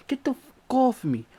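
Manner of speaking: high, strained curse; cursing at the attacker, high and raw; cursing yell. Retold or imitated. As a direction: spit the curse at him. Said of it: "I'm cursing".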